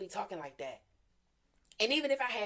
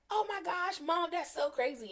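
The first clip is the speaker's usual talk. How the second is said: much higher, thrilled retelling of the daughter's gasp; excited gasp; 10 semitones higher than usual. Retold or imitated. Middle daughter telling her mom, excited and high. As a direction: imitate the daughter's shocked delight, pitched way up.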